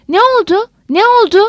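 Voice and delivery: high, urgent narrated question; alarmed questioning. A narrator's reading. Bogaevski running up with trembling lips, alarmed questioning. Bogaevski arrives shaken at the news.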